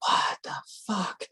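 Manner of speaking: slow, aghast whisper; whispered disbelief. Retold or imitated. Seeing the skin look way worse, whispered disbelief. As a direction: whisper it slowly, as if horrified.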